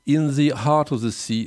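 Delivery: put-on slow, careful English accent on the title; slow English accent, savouring each word. Host proud of his English accent reading the title. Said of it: "j'adore mon accent anglais".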